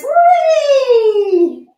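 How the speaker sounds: loud, high gliding "wheee!"; high "whee". Shown as a demonstration. Teacher rolls through the pose, high "whee". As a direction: squeal a long whee, falling at the end. Cheer during the rolling pose.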